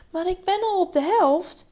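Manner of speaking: high, pleading objection; plaintive protest. A narrator's reading. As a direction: protest that you deserve credit. Meek protest against self-criticism.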